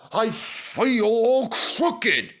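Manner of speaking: high, slow plaintive moan; complaining. Acted. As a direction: whine it, hurt and lopsided. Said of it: "he complained".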